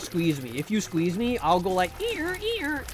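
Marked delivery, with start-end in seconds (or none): 2.0-2.8 high squeaky toy squeal; squeaky dog toy. Retold or imitated. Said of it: "like a funny little dog toy".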